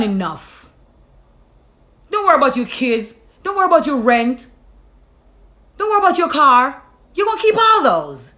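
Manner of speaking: emphatic reassurance, building in force; firmly command, then reassure item by item, rising; insistent inner voice. Retold or imitated. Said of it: "And something said".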